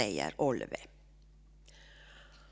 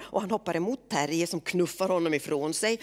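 usual speaking voice

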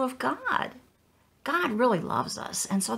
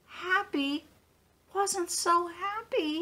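normal talking voice

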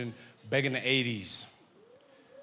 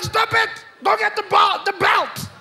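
very high, loud yelling dad; furious dad; 9 dB louder than usual. Retold or imitated. Dad ordering the belt, very high furious yell. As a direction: scream for the belt.

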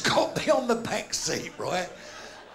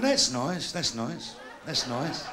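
fading echo; 8 semitones lower, slower than usual. Acted. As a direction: let it die away like an echo. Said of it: "that was a fucking echo".

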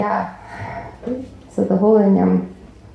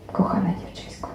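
whispered; 7 dB quieter than usual. Acted. Final tender farewell line.

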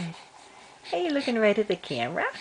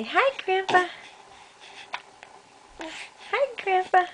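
put-on high baby voice; 7 semitones higher than usual.

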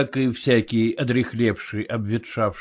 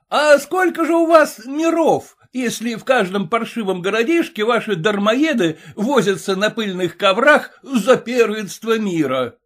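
mocking heckler; 9 semitones higher than usual. A narrator's reading.

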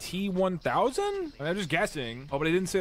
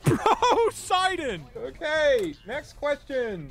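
high drawn-out laugh through words; 7 semitones higher, much slower than usual. Real, not acted. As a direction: crack up at the word. Laughing echo of bro word.

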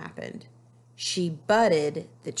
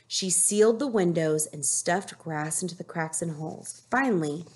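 usual speaking voice

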